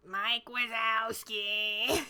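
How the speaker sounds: drawn-out slow character imitation; cranky cartoon lady. Imitating the Monsters, Inc. lady calling out, cranky. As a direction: stretch the name out like a crabby old lady. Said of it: "Monsters, Inc".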